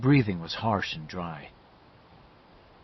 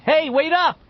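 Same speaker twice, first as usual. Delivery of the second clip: very high, loud call; shout; 8 dB louder than usual. A narrator's reading. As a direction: yell after friends to make them wait. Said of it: "he shouted".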